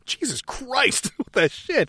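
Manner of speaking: mock astonishment. Retold shock at speedometer.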